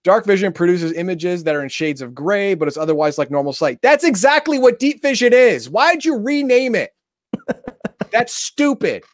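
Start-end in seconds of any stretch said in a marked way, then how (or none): 3.8-9.0 real outrage, pitched way up; exasperated rant. Real, not acted. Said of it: "I'm pissed off".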